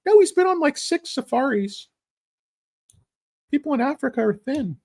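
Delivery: high-pitched parody of a fussy mom; mock snooty mom. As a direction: squeak it out like an apologetic helicopter mom. Voicing the same mom.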